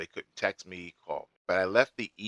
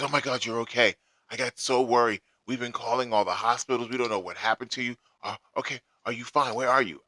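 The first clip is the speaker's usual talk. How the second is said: imitating her panicked, higher voice, words tumbling out; frantic; 6 semitones higher, 6 dB louder than usual. Retold woman on the phone, frantic and higher. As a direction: play the worried caller who finally got through. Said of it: "frantic kind of situation".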